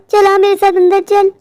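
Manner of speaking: ordinary talk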